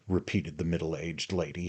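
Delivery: ordinary delivery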